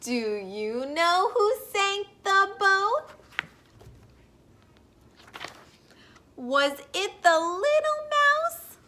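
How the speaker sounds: slow, sing-song picture-book read-aloud; gentle storybook voice for little listeners; reading to children. Acted. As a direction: read it like story time on the rug.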